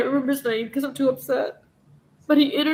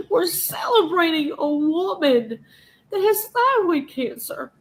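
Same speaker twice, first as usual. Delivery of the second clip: slow, higher, breaking with tears; near tears; 5 semitones higher, slower than usual. Real, not acted. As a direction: voice cracking as she pleads. Upset rant about cancer.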